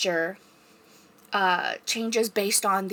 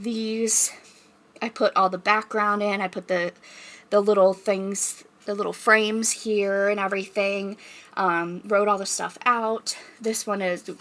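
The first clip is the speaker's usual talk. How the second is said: ordinary talk, nothing marked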